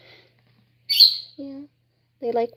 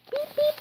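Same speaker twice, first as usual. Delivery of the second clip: beep beep. Pretend. Imitating bird call.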